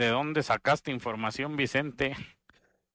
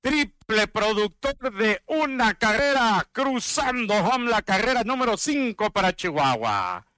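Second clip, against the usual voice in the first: announcer call; 5 semitones higher than usual. Acted. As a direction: announce the run scoring. Announces the run scoring.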